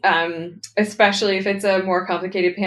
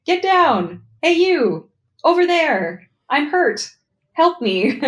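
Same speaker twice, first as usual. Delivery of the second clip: higher, lightly acted combat call-outs; video-game enemy barks; 8 semitones higher, 3 dB louder, slower than usual. Game writer imitating enemies' combat barks, mock urgent shouts. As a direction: call out short mock battle alerts, pitched up. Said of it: "like, enemies saying".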